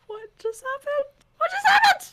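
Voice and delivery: from stunned squeak to wailing shout; hammed-up high despairing cry; mock anguished cry. Stunned reader, escalating.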